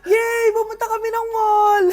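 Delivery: high, loud happy yell; excited cheer. Acted. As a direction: cheer like a thrilled kid. Child cheering. (masayang sigaw ng bata)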